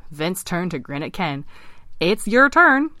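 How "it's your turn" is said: high, loud little frog; teasing frog. Acted. Vince grinning at Ken as his turn comes, teasing. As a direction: sing out a gleeful challenge, high.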